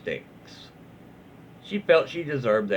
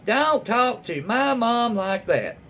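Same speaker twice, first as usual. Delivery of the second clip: put-on higher, slow, bratty teen defending her mum; snippy daughter; 9 semitones higher, slower than usual. Daughter scolding the upholsterer for her mum, bratty and slow. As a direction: drag the words like a sulky daughter. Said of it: "Tell the daughter".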